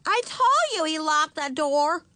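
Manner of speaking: put-on loud, high little-girl voice; insistent child. A narrator's reading. Ramona repeating her claim to the family, insistent. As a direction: insist loudly like a small child.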